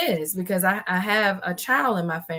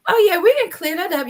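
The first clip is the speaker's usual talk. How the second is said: higher, louder, chirpy doctor reassurance; breezy dermatologist; 10 semitones higher, 5 dB louder than usual. Retold or imitated. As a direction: play the cheerful doctor brushing it off. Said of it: "they like".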